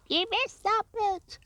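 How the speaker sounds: high, hammed-up shriek of a heckling viewer; mock viewer shout. Imagined viewer heckling the beachcomber, high mock shriek. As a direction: squeal it like an indignant viewer. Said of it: "screaming at me, going".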